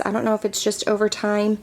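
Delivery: ordinary talk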